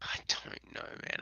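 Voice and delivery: breathy whisper. Real, not acted. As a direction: whisper it under your breath. Unsure about a name.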